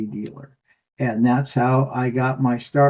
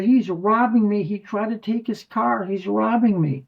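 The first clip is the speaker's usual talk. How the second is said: retold yelling; 7 semitones higher than usual. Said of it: "Sarah's yelling".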